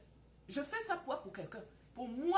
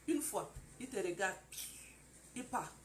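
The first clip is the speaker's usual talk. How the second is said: normal talking voice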